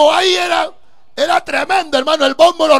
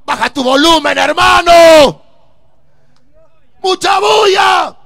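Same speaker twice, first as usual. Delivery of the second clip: angry demand; 4 semitones higher, 7 dB louder than usual. Retold or imitated. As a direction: yell at them to turn it down. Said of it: "le decíamos al que estaba encargado".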